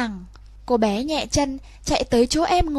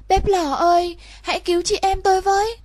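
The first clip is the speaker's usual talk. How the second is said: higher, fast urgent plea; crying for help; 6 semitones higher than usual. Acted. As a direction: beg the stove to save them. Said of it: "cầu cứu". (cô bé cầu cứu bếp lò, giọng cao hối hả)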